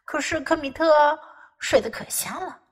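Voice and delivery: usual speaking voice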